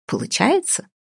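high rising, ironic question. A narrator's reading. Mother doubts the attempt at growing serious, ironically. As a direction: lift the word into a mocking query. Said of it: "иронически уточнила".

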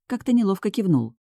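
usual speaking voice

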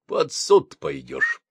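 captain calmly warning the driver. Acted. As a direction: state it calmly, no anger. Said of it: "спокойно пояснил".

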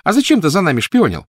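put-on high, loud girlish challenge; accusing child. A narrator's reading. Petunia challenging the boy about spying, accusing. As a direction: demand an answer, accusing.

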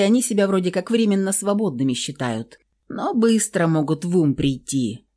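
usual speaking voice

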